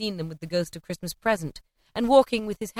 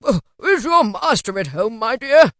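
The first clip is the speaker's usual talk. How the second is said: put-on loud, kindly old man's question; genial Scrooge; 3 semitones higher, 7 dB louder than usual. A narrator's reading. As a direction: greet the maid heartily. Scrooge greets the servant girl.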